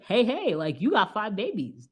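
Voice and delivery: a hint of a smooth talent manager; mock slick manager. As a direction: pitch it like a schemer. Voicing the manager Albert.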